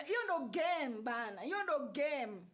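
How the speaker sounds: ordinary talk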